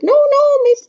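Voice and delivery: high, loud squeaky fish protest; fussy fish voice. Acted. The fish objecting in the story, high squeaky voice. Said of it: "And our fish said".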